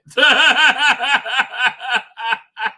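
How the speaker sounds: high, loud rolling laugh; real belly laugh. Real, not acted. Man amused by what he shows, real loud laughter. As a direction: crack up at length.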